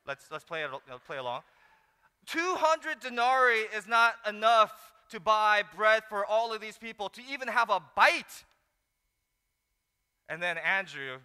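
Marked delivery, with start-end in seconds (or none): 2.3-8.4 snarky. Acted. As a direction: scoff at the idea like it's absurd. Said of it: "this snarky".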